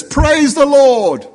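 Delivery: very high, loud, drawn-out cry; old lady's praise shout. Acted. Old lady ending her prayer on the porch, exultant shout. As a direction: belt it high and slow. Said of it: "And then she says".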